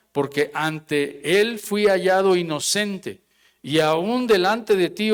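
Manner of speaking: measured Bible reading, the king's words slowed; scripture reading. Acted. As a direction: read the verse with weight. Reading Daniel's reply.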